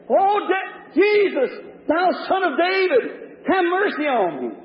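high-pitched, desperate calling out; pleading cry. Acted. Blind man calling to the passing Son of David, pleading. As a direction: cry out for mercy from afar. Blind beggar calls out to Jesus.

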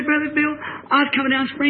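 put-on high, distraught girl's voice; imitated crying. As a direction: plead like the crying girl. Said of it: "she's just crying like that".

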